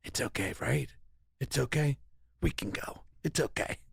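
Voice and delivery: hushed, fading to an unvoiced whisper; anxious whispered it's okay; nervous reassurance. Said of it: "I'd be like".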